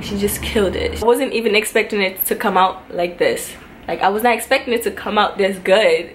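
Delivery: normal talking voice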